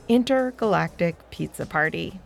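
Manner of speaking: plain reading voice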